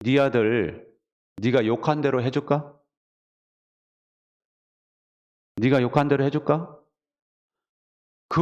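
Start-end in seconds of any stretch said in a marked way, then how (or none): 0.0-6.8 lightly voiced God, lower and measured with a rising question; God's voice. God to a cursing mother, in a lightly voiced low tone. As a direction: pose it as God, low and unhurried. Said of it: "하나님이 음성을 딱 들려주시더래요".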